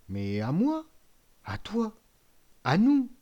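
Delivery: can't believe the gold is theirs; delighted disbelief. Acted. Old father sees the coins, high delighted exclamation.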